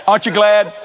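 ordinary talk, nothing marked

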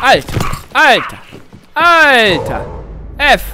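Alter! barked out loud, again and again; loud startled outburst. Real, not acted. Gamer yelling at the attack, loud outburst. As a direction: shout it in shock as the enemy hits.

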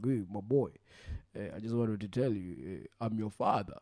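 deep-ish father act; fake father. Prank call as fake father.

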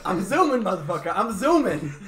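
laughing, high and loud; laughing mock hype. Mocking friend's catchphrase, laughing.

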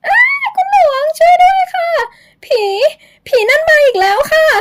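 terrified scream. Acted. Young woman screams to Luang at the ghost, terrified. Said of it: "หญิงสาวกรีดร้องอย่างหวาดกลัว".

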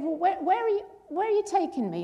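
keen to be off, stumbling over the words; excited. Retold or imitated. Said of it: "he got quite excited".

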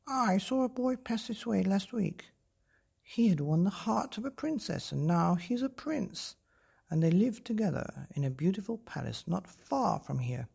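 high crow voice telling news; a chatty old crow. Acted. Crow answers Gerda.

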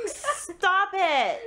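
loud, high yell; drawn-out frustrated yell; exasperated shout. Real, not acted. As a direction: shout it in protest, falling at the end. Exasperated at laughing opponent.

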